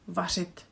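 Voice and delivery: vařit with ř said like ž; ř sound. Shown as a demonstration. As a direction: focus on the ř in vařit, close to ž. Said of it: "our favorite ž".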